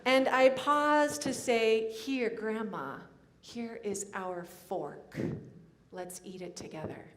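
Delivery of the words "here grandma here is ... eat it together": soft and intimate; tender offering. Acted. Minister offering her late grandmother a fork, soft and tender. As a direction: share it softly, as if she sits beside you.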